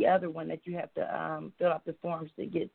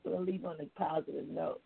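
ordinary talk

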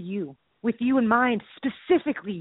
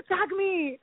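high, drawn-out mock plea; hammed-up movie wrestler begging; pleading wrestler; 6 semitones higher, 3 dB quieter than usual. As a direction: call out to be tagged in. Acting wrestler begging again.

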